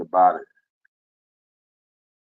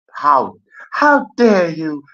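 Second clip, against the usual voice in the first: slow, higher, indignant how dare you; mock outrage; 6 semitones higher, 4 dB louder, slower than usual. Streamer scolding the BBC over Doctor Who, slow mock outrage. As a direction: drag it out like a scandalised accuser.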